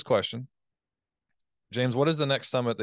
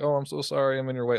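higher, pleading, overly sorry tone; simpering apology, raised pitch; mock apologetic; 6 semitones higher, 3 dB louder than usual. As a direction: play the groveling cold caller, pitch up. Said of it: "people wanna be apologetic".

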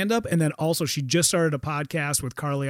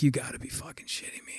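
breathless whisper of disbelief; stunned whisper; 5 semitones lower, 11 dB quieter, faster than usual. Retold or imitated. Retelling his shock at the famous caller, whispered. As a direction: whisper it like you can't believe who's calling. Stunned reaction after Dana Carvey calls.

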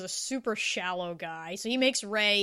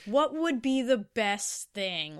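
sly Kyoko; 5 semitones higher than usual. Retold or imitated. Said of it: "Kyoko calls him and is like".

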